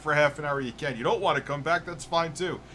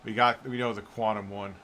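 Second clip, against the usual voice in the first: usual speaking voice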